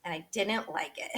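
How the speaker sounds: ordinary delivery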